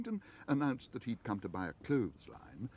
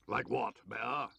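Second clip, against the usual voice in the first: grumpy neighbour; slower than usual. A narrator's reading.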